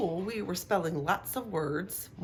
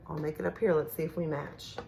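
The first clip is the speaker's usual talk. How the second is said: ordinary talk, nothing marked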